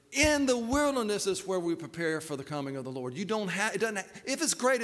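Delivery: normal talking voice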